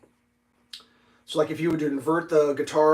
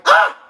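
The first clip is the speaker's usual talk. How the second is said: surprised gasp; 13 dB louder than usual. Retold or imitated. Surprised at finding empty cases.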